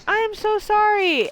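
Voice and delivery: high, slow squeaky apology; meek gnome. Retold or imitated. Gnome apologizing to the dwarves, high and slow. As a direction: squeak it out like a terrified little gnome. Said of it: "the gnome is like".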